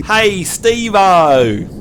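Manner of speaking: very drawn-out Aussie lout's call; louty Australian. Shown as a demonstration. Host corrects the too-American attempt, louty Australian accent. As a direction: stretch it out in loutish broad Australian. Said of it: "a bit more louty".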